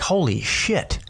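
stunned aside, playfully retold; retold amazement. Man retelling his shock at a cartoon Guido guy, amazed.

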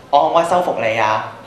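usual speaking voice

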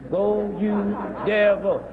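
slow, low drawn-out chant; mock team cheer. Mocking Christians rooting for the devils at the game, drawn-out chant. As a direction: parody a fan chant, stretching each word.

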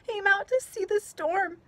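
put-on a little girl upset in the storm; upset child. A narrator's reading. Stina to grandpa on the rock, tearful and high.

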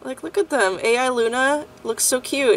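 usual speaking voice

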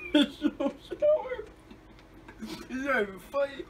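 laughing through the words. Real, not acted. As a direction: laugh while wincing at sourness. Laughing at the sour candy.

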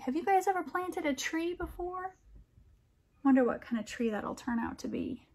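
usual speaking voice

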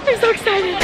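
ordinary delivery

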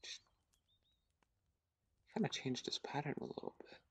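hushed, breath-only murmur to self; soft, concentrating whisper. Real, not acted. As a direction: whisper it softly, as if thinking aloud. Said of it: "Sorry if I'm whispering".

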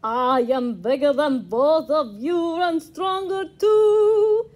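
sing-song boasting vampire; boastful vampire. Acted. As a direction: brag it like a proud vampire. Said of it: "boasted the vampire".

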